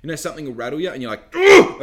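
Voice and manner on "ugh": loud sudden frustrated ugh, much higher; a burst of irritation; exasperated groan. Retold or imitated. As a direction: blurt out a big fed-up groan. Something rattles you, you react.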